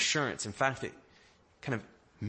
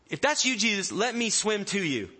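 high, raised-voice plea; calling out; 8 semitones higher, 4 dB louder than usual. Acted. Peter asking Jesus from the boat, calling out. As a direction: call across the waves, acted.

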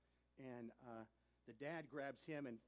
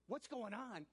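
higher and louder, incredulous and urgent; exasperated retort; 10 semitones higher, 7 dB louder than usual. Retold or imitated. Teen in the police car snapping at clueless Ralph, loud and high. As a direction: tell him off in alarm. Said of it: "I said".

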